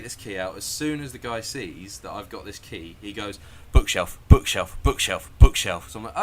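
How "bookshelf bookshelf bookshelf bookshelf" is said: mock insistent shouting; urgent order. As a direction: imitate a guide shouting one command on repeat. Imitates guide urgently giving orders.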